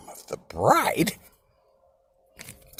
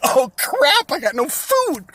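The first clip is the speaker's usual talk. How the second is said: mock panic; 13 semitones higher, 12 dB louder, slower than usual. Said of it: "they're like".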